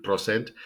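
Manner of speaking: "percent" said as "pro-cent"; mispronounced percent. Shown as a demonstration. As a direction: make the first syllable of "percent" "pro". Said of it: "I hear this a lot".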